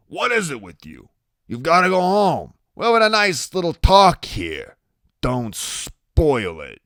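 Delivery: scolding as a story tough guy; Iggy, testy. Acted. Story figure Iggy speaking.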